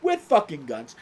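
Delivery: usual speaking voice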